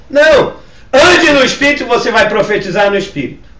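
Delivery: high, loud preacher's command; emphatic exhortation. Real, not acted. Pastor correcting those who lean on the church, loud exhortation. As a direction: burst out with a firm correction. Exhorts congregation after mocking objection.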